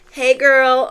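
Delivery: a lightly voiced drunk stranger; imitating the drunk guy. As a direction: play the stoned guy hitting on her. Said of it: "He was like".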